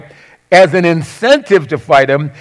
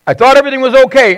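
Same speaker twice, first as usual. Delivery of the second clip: high, fast retold protest of disbelief; distressed disbelief; 9 semitones higher, much faster than usual. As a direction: imitate a bewildered spouse, pitched up and rushed. Acts out a deserted spouse's distress.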